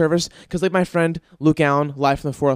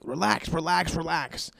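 soft, put-on voice for the dog, much quieter; 9 dB quieter than usual. Voicing the dog Bello calming the host, soft put-on voice. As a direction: hush him like a calming pet. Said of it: "biting my ankles and goes".

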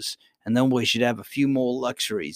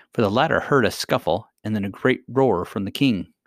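ordinary delivery

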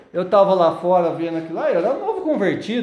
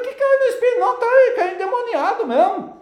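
incredulous; 12 semitones higher than usual. Retold or imitated.

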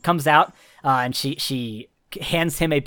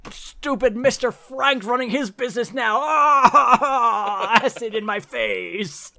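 high, loud pained howls; hammed-up agony, shrieking and wailing; howling in pain; 9 semitones higher, 3 dB louder, slower than usual. Billionaire shrieks as acid hits his face, high and loud.

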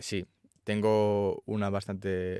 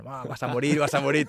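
higher, louder jeering devil taunt; mocking little devils; 5 semitones higher, 8 dB louder than usual. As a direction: gloat like a laughing imp. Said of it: "igual, riéndose".

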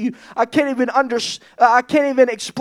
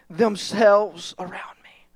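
hushed whisper; 5 semitones lower, 7 dB quieter than usual. Real, not acted. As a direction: whisper it softly. Recalling God's embrace.

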